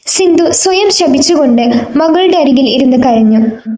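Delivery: usual speaking voice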